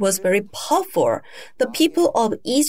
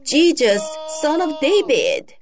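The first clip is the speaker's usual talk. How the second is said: raised, higher-pitched calling out; loud cry; 6 semitones higher than usual. Retold or imitated. Blind man calling to Jesus, crying out loudly. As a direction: call out over a crowd, pitch lifted. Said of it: "He cried out loudly".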